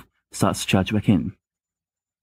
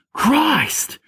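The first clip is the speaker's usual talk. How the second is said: sudden high startled yell; woken-up shout of protest. Acted. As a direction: bark it out, jolted awake. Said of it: "James shouts from his bed".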